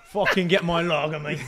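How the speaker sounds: laughing, jokey Cockney lad; mock Cockney accent through a laugh. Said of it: "I'll put on a Cockney accent".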